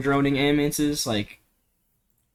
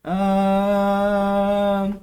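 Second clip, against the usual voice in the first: a held, steady higher "uh" drone; vocal drone; 6 semitones higher than usual. Shown as a demonstration. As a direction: hold one flat sung-like tone. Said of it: "Or try vocal droning".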